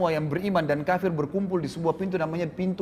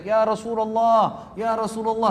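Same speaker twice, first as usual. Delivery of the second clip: calling out from afar; 5 semitones higher, slower than usual. Acted. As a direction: shout it across the crowd. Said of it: "sengaja teriak-teriak gitu".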